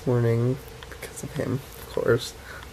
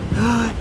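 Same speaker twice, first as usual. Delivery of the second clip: excited gasp; 9 semitones higher, 4 dB louder than usual. Real, not acted.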